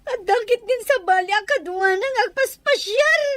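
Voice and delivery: sobbing complaint; acted crying. Wronged daughter complaining to her mother, crying. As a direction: sob out the grievance between breaths. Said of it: "apay nagsangsangit ka".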